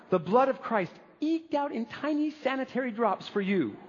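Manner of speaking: mock a prim, tidy serving in a thin high voice; mock-dainty. Mocks tiny, tidy communion servings.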